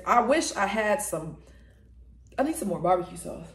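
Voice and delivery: ordinary talk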